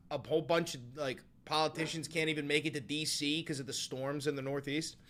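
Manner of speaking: ordinary talk, nothing marked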